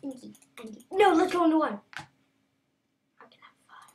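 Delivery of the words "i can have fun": breathy whisper, no voice at all; playful secret whisper to a new friend. Pretend. Talking secretly to a mob.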